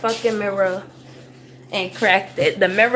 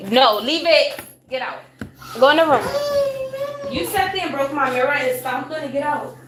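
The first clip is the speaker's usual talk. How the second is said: higher-pitched parental order; real scolding; 6 semitones higher than usual. Real, not acted. Scolding child over broken mirror.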